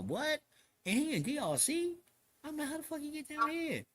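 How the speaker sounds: high-pitched disbelieving questions; retold incredulity. Inmate questioning how the man got into DRC, high disbelief. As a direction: push the questions up high, astonished.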